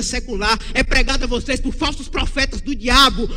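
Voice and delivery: loud, driving sermon voice; full-force pulpit denunciation; fiery preaching. Acted. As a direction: thunder it at the congregation.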